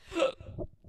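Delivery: sharp involuntary hiccup; a loud unvoiced hiccup; hiccup gasp. Real, not acted.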